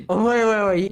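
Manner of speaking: usual speaking voice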